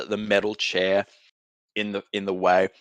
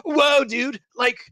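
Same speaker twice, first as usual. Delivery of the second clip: high, dazed surfer dude; a stunned, spacey high-pitched dude; stoner surfer voice; slower than usual. Acted. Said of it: "And they're like".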